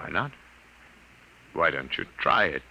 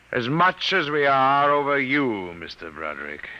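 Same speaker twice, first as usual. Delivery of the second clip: gloating; 9 semitones higher, 6 dB louder than usual. Acted. Alien gloats over humans.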